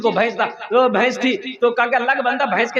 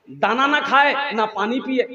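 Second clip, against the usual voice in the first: normal talking voice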